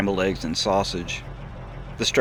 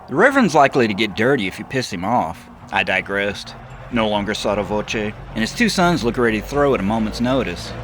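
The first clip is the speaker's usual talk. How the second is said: louder, higher worried warning; narrator's own voice; 5 semitones higher, 5 dB louder than usual. Acted. Narrator warns Seth about the Reverend's sons. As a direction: build from caution to alarm.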